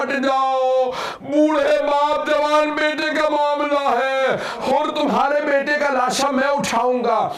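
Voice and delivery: high, grieving majlis recital; lamenting recitation at full force; impassioned preaching. Acted. Majlis recital rising in grief.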